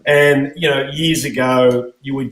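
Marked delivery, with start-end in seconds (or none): none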